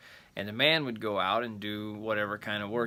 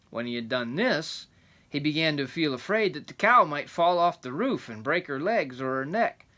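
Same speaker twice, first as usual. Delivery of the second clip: rambling grandpa story; 3 semitones higher, 5 dB louder than usual. A narrator's reading. Reading the folk tale aloud.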